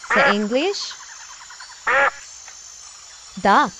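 put-on children's teacher calling out words; teacher vocabulary drill. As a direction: announce each animal name to little kids.

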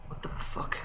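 hushed whispered curse under the breath; whispered swear. Real, not acted. Lost player whispers to himself in the dark maze, hushed.

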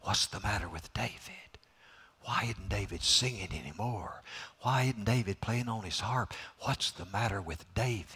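low, hushed palace whisper; servants whispering. Retold or imitated. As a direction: murmur it like worried servants behind a door. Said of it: "servants around the palace whisper to one another".

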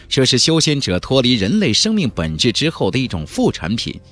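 ordinary delivery, nothing marked

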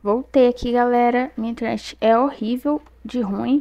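usual speaking voice

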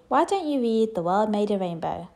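usual speaking voice

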